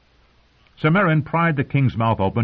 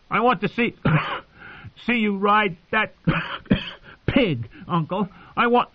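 coughing fit; 11 semitones higher than usual. Acted. Joffrey coughs while taunting his uncle, choking. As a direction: choke the words out between coughs. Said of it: "his words broke up in a fit of coughing".

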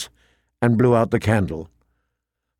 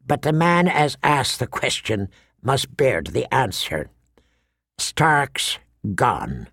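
put-on grim old Yoren; 2 semitones lower, 3 dB quieter than usual. Voices old Yoren giving bad news.

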